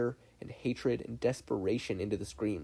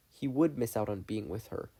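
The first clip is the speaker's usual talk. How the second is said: ordinary delivery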